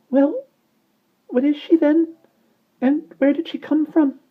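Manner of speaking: very high, shrill chick cry. Acted. Fledgling flapping his wings, crying very high and shrill. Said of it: "cried the fledgling shrilly".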